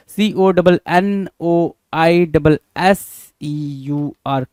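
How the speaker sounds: each letter of C O double N spaced apart; slow letter-by-letter spelling. Shown as a demonstration. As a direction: spell convoisseur out very slowly. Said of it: "कन्वाइजर की spelling को हमेशा ध्यान में रखें".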